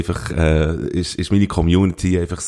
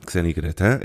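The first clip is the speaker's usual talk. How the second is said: normal talking voice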